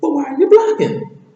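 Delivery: loud, squealing high accusation; shrieky, high-pitched sidekick; Donkey. Acted. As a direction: pester at the top of the voice. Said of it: "Donkey goes back and says".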